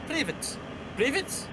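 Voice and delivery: high hammed-up Russian accent. Shown as a demonstration. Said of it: "how do I do a Russian accent?".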